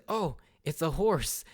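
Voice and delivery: slow, dopey cartoon boy; goofy Luffy voice. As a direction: say it like a clueless kid. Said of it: "Luffy says".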